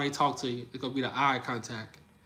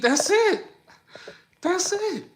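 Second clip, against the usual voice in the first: much higher, squeaky teasing line; high flirty tease; 3 dB louder, slower than usual. Shown as a demonstration. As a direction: go way up into a coy tease. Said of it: "That's how they flirt with you".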